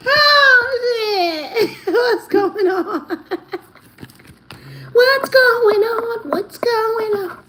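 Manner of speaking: high, slow, laughing disbelief; real shock breaking into laughs. Real, not acted. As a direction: stretch it out in amazed laughter.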